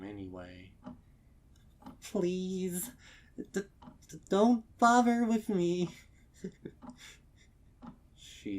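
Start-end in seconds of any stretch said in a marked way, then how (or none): 2.0-5.9 high, loud, broken sobbing words; hiccuping through sobs. Acted. Crying mare telling the stallion not to bother, hiccuping. 6.4-7.1 broken laugh. Acted. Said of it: "Her face cracks into a wide grin".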